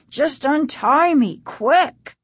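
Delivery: high, pressing command; urgent. A narrator's reading. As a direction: press hard to be freed. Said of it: "their father said urgently".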